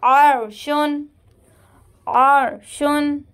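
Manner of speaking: अर्शुन with a thick, rounded r, slowly recited; tajweed demo of heavy sakin ra. Shown as a demonstration. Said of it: "रा को पुर पढ़ेंगे".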